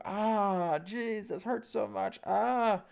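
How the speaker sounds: high, strained mock cry of pain; overdramatic pain. Teacher mocks someone overreacting to an injury with a high pained cry. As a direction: ham up the agony of a small hurt. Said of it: "you go".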